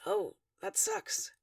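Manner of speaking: slow, soft and gentle; sympathetic. A narrator's reading. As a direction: ease it out with quiet sympathy. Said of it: "Dave says sympathetically".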